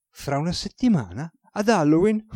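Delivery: can't believe the date, pitch jumping up; amazed question. Acted.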